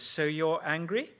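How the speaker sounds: lightly voiced counsellor asking; a hint of a probing counsellor; counsellor's question. Preacher acting out counselling, mild questioning tone. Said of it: "the conversation goes like this".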